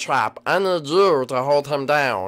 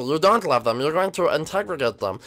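ordinary delivery

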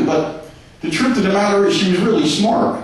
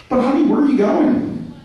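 higher, plaintive plea; lightly voiced husband, higher and hurt; mock pleading husband; 7 semitones higher than usual. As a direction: beg the leaving wife to stay.

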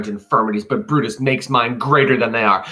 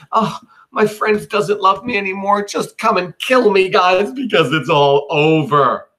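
whiny, much higher mock lament, slowing at the end; mock self-pity; 9 semitones higher, 4 dB louder than usual. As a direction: ham up a wounded friend begging to die. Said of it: "he just looked around and he said".

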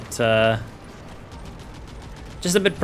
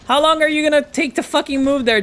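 high, raised fed-up yell; exasperated rant; 13 semitones higher than usual. Real, not acted. Yelling at slow game units.

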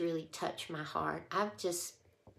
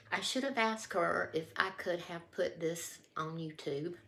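usual speaking voice

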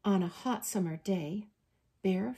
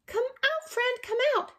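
high, squeaky-pitched storybook shout; mouse shouting; 4 dB louder, faster than usual. A narrator's reading. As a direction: call out as a tiny mouse. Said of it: "Mouse starts to shout".

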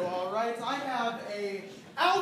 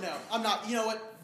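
ordinary talk

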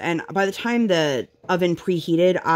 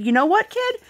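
raised, sing-song mock enthusiasm; playful high mom line. Mother telling her toddler yes to barbecue, playfully high. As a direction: lift it up like giving in cheerfully. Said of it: "And I said".

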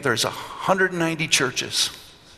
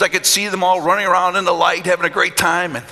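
ordinary talk, nothing marked

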